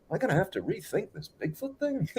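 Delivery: the doubter starting to waver; doubting boss. Retold or imitated. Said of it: "the guy that thought it was all B S is like".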